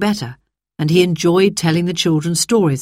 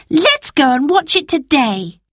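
eager cry; 9 semitones higher than usual. Acted. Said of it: "cried Phyllis".

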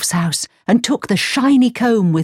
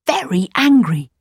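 plain reading voice

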